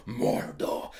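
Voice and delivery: "Mordor" breathed as a hushed whisper; dramatic whisper. Retold or imitated. The show not saying the name, hushed whisper "Mordor". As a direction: whisper "Mordor" like a secret reveal. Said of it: "He didn't go".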